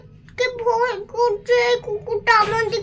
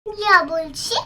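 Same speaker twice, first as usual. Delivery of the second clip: usual speaking voice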